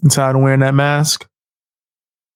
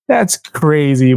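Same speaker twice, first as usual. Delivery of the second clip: usual speaking voice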